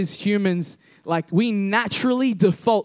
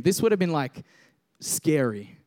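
normal talking voice

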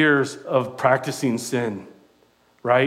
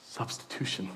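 whisper; 4 semitones lower, 16 dB quieter than usual. Real, not acted. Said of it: "whispered repeat of the key word".

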